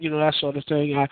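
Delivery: ordinary delivery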